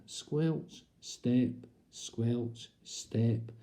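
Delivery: slow, rhythmic squelch and step; mock soggy footsteps. Shown as a demonstration. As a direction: pace it like wet footsteps. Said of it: "mimicking wet steps down the aisle".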